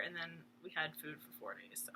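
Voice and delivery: soft whispered afterthought; whispered aside. Real, not acted. Whispering an aside to viewers about the feast.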